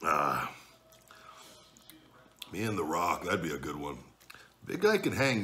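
chewing while talking; eating between words; mouth full of pasta. Real, not acted. Seen 1: Takes a bite at -3, keeps chewing, holds loaded fork.

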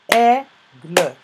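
"Aigle" split as AI... GLE, loud first syllable. Shown as a demonstration. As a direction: pause inside "Aigle" and sound the final e. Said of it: "Je l'entends dans la première syllabe". (« Aigle » découpé en syllabes)

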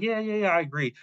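high-pitched, placating yes-man agreement; mock yes-man, voice lifted high; eager agreement. Acting Paul placating to his face.

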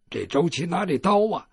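ordinary delivery

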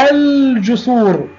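الجسور slowly with a held l; moon lam. Shown as a demonstration. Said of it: "لام قمرية". (نطق «الجسور» ببطء بلام ساكنة واضحة)

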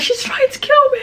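very high imitated wail; retold crying. Storyteller telling how she accused her roommate, high mock-crying voice. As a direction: squeal it as a tearful reenacted accusation. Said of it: "And I was crying".

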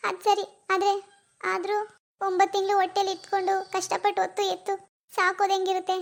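put-on a grieving young woman close to sobbing; tearful daughter. Daughter grieving her lost baby to her mom, tearful. Daughter refusing adoption comfort.

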